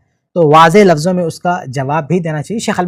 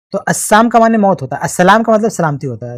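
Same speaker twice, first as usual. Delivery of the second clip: normal talking voice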